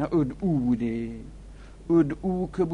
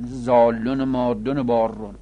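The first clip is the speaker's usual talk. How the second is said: ordinary talk, nothing marked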